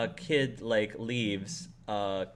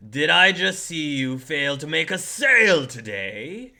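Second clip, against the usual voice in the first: loud, raised-pitch scolding; indignant boss; 5 semitones higher, 6 dB louder than usual. Acted. Boss confronting an employee over a lost sale, loud and indignant. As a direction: confront them with swooping disbelief.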